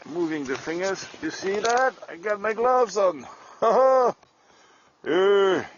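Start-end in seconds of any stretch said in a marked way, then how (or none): 1.2-5.6 pleased laugh. Real, not acted. Showing off gloves, laughing.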